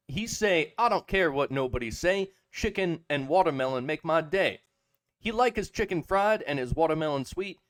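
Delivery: lively put-on slang storytelling voice; mock slang storyteller. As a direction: ham up a swaggering street-talk teller. Reads ChatGPT poem aloud.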